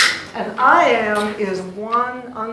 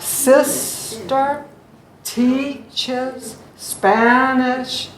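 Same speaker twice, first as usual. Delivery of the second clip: "sister teaches Spanish" very slow, SIS-ter, TEACH-es, SPAN-ish; "sister teaches Spanish" with long pauses, first syllables punched; syllables drawn out; 4 semitones higher, 6 dB louder, much slower than usual. Shown as a demonstration. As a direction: break "sister teaches Spanish" into loud, stretched beats. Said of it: "see how that's marked?".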